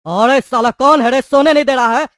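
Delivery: furious just-woken shout; angry yell. Acted. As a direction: roar at whoever woke you. Woken prisoner yells at noise.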